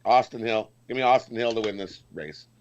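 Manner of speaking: normal talking voice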